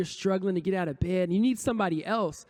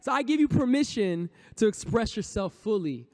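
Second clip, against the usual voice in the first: ordinary talk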